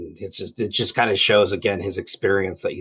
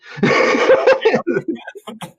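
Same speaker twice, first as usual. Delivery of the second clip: high laugh. Real, not acted. Laughing after the quote.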